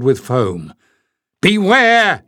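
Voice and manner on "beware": loud high warning shout. A narrator's reading. Leif warns his companions of the attack, shouting. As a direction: yell the warning to everyone. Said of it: "he shouted".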